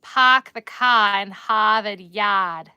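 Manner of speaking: loud, slow Boston "Park the car in Harvard Yard" with no r sounds; hammed-up Boston tourist line; Boston accent belted out slowly. Shown as a demonstration. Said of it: "Boston is hard".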